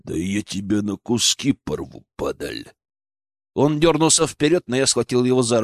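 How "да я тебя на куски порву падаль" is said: low, quiet threat, growled; vicious growl. A narrator's reading. Медведь threatens his enemy, growling viciously. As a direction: threaten under the breath, sinking lower. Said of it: "Злобно прорычал медведь".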